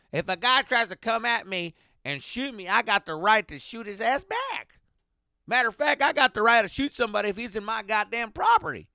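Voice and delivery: hammed-up gun-loving driver, loud and high; Texan driver rant. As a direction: play the driver going off on guns. Voicing the Texan driver ranting.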